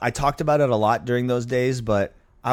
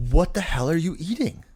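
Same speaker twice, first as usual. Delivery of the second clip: sharp, higher retort in disbelief; exasperated doctor; 4 semitones higher than usual. Retold or imitated. Doctor scolding a diabetic patient, exasperated. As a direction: snap it at the patient, half mocking. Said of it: "I go".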